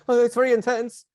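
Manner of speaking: high, loud panicky exclamation; hammed-up panic, pitch jumping up; alarmed reaction. Shown as a demonstration. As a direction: overreact in alarm.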